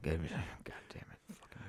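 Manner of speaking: very soft grumble under the breath; muttering. Acted. Faking a prerecorded busy-at-work track, muttered curses. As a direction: mutter to yourself, barely audible. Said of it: "you're, like, muttering".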